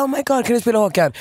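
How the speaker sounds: high, rushed fan squeal in imitation; mock gushing fan. Fan begging the DJ for [name], mock excited. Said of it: "alltså inte så här".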